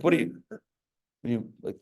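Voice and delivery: ordinary talk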